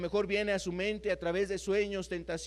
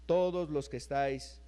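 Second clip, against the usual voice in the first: lower, softer pastoral invitation; tender, hushed sermon voice; gentle preaching; 8 semitones lower, 4 dB quieter than usual. Acted. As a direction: draw the tired listener in gently. Closing soft invitation.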